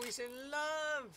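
high, drawn-out delighted cry; delighted gush. Real, not acted. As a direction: stretch it out in giddy delight. Reacting to lovebird characters.

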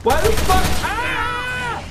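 very high shrieking yells of disgust and panic; shrieking at a monster that jumps on you; terrified screaming. Real, not acted. Monster attacks, player screams.

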